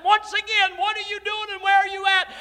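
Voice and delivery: high, loud cry to heaven; high, loud preaching complaint; shouted plea to God. Retold or imitated. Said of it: "I said".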